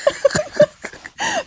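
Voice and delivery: high peal of laughter. Real, not acted. Cohost trying to get to the highlights, high laugh. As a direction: laugh out high before carrying on.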